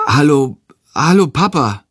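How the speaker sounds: hesitant hello, repeated in disbelief; a touch of bewilderment; flabbergasted. A narrator's reading. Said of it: "sagt er verdattert".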